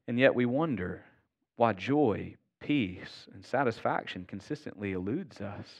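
ordinary talk

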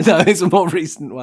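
loud, high, laughing protest; amused, laughing correction. Real, not acted. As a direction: answer through real laughter. Laughing at the X-Files guess.

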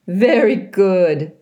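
slow, amused goose praise; giggling old goose. A narrator's reading. Loudwing praising Brightbill, slow giggling goose voice. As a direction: chuckle it out fondly. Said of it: "the old goose giggled".

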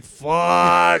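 loud comic groaning curse; mock exasperation. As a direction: play up the kid's dismay. Retold kid's exasperation at long goodbyes.

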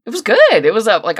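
ordinary talk, nothing marked

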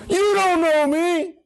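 high, slow, ringing cry of it; dramatic cry. Acted. Jesus crying out to those who doubt him, high and slow. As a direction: cry it out to the crowd.

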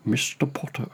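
quiet, measured villainous address; Alan Rickman. Acted. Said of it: "it doesn't sound like Alan Rickman at all".

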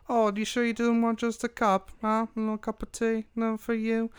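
high, drifting fake British accent; tiny worm offering tea in a shaky accent; wobbly Cockney worm. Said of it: "his accent keeps changing".

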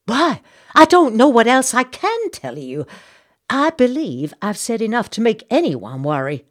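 high, loud, indignant Southern lady; genteel outrage, fanning herself; Southern belle gossip. Acted. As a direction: play the scandalised society matron. Missus Bartlett speaks again.

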